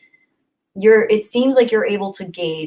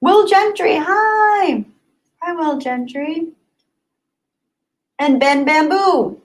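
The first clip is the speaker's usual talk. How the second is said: excited; 9 semitones higher, 4 dB louder, slower than usual. Real, not acted. High sing-song greetings.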